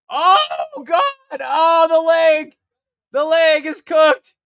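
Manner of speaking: loud, slow, horrified cry; horrified shout. Real, not acted. Watching a fighter's leg break, a horrified slow shout. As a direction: yell out in shock at the broken leg.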